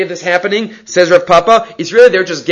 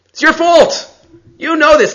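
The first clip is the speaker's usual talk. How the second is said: high, pushy blaming tone; scolding sellers; 12 semitones higher than usual. Retold or imitated. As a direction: throw the blame back at him, shrill and sharp. Sellers scolding Zeiri, acted quote.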